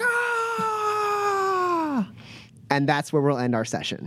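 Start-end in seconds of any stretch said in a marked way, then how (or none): 0.0-2.1 crowd cheer. Acted. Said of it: "everyone's like".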